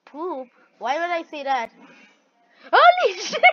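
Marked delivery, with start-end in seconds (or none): 2.7-3.4 high, loud burst of laughing shock; shocked laughing yell. Real, not acted. Gamer laughing in shock at the screen. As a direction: blurt it out laughing. Sudden shock during game.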